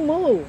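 girl covering the kitten, mock mooing; mock cow moo. A narrator's reading. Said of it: "and moo".

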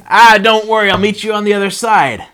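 high, loud call across water. Acted. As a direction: holler a breezy send-off to friends leaving. Jesus calls to departing boat.

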